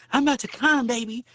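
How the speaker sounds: put-on high bedroom voice; imitated lover. Mousy back there announcing himself, in a high mocking voice. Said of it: "And he back there".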